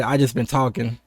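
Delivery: usual speaking voice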